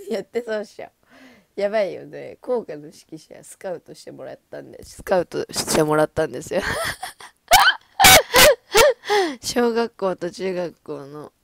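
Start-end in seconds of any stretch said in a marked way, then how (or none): 0.0-0.9 higher, laughing as she says it again; laughing repeat. Real, not acted. 4.8-6.6 much louder, insisting on it; loud emphatic repeat. Real, not acted. 6.7-9.4 high, loud burst of laughter. Real, not acted. Bursts out laughing at own story.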